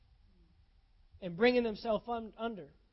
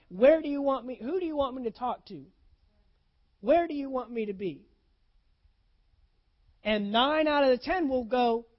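preaching; 6 dB louder than usual. Real, not acted. Preaching at full force.

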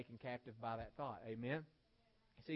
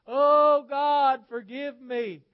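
huge high-pitched holler, words stretched out in anguish; hollered wailing; 13 semitones higher, 27 dB louder, much slower than usual. Retold or imitated. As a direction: imitate a woman hollering in torment at full volume. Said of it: "She'd holler out".

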